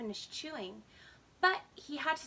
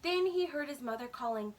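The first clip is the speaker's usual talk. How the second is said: plain reading voice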